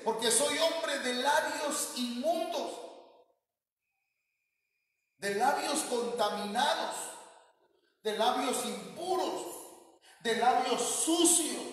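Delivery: high, drawn-out confession of unclean lips; prophet's lament. Retold or imitated. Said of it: "Porque dice". (confesión lenta y aguda del profeta)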